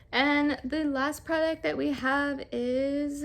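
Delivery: slow, drawn-out announcer's build-up; a touch of wrestling-announcer delivery. Real, not acted. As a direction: stretch it out like a ring announcer. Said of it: "I sound like a wrestling announcer".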